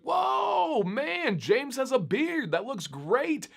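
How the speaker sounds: high, amazed shout at seeing the beard; excited shout. Retold or imitated. Friend seeing a beard under the mask, excited shout. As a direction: burst out in delighted surprise.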